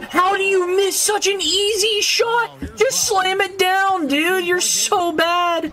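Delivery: high-pitched gamer rage at a missed easy dunk; frustrated yelling. Real, not acted. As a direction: yell your disbelief at a bad teammate. Yelling at teammates over misses.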